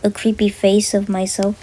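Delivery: loud, intense storytelling; loud narration. A narrator's reading. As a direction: tell the nightmare loudly.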